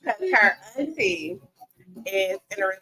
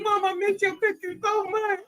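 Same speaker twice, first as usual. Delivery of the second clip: imitating daughter; 10 semitones higher than usual. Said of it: "she was like".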